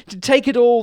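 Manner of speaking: very high, loud plea; loud storybook panic; panicked cry. A narrator's reading. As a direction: cry out like terrified dragons giving in. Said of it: "they cried".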